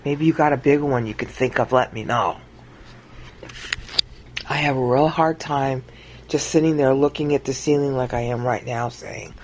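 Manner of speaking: usual speaking voice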